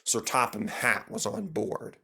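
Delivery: ordinary delivery, nothing marked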